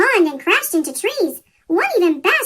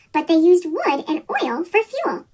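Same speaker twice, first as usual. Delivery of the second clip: ordinary delivery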